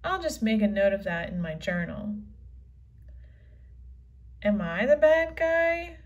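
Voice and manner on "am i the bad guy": normal talking voice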